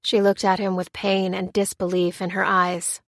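plain reading voice

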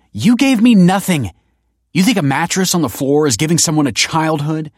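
high, loud, furious retort; angry outburst. Acted. Kelsey throws her bad childhood at Tony, angry and loud. Acted quarrel with drunk father.

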